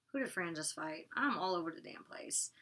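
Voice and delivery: whispered under her breath, half to herself; whispered admission of losing track; whispered aside. Real, not acted. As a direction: whisper it as a sheepish self-correction.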